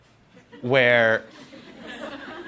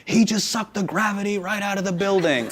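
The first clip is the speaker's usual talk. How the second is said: higher play-by-play announcer voice; Kevin Harlan; 4 semitones higher, 4 dB quieter than usual. Acted. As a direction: call it like a corny sports commentator. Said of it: "Kevin Harlan".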